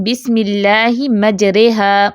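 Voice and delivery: slow, مجریہا with the ra leaning toward e; slow Quranic recitation with imala; recited with imala. Shown as a demonstration. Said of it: "سورۃ ہود آیت number forty one میں ہے نا".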